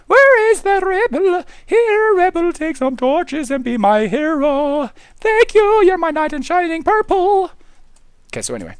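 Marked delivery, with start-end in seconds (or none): 0.1-7.5 higher cooing voice; lightly cutesy baby talk; sweet pet voice. Acted. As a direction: coo at the cat like a hero.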